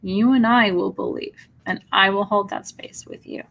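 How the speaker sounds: ordinary delivery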